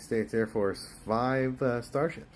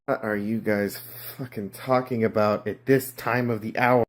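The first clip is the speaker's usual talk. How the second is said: ordinary talk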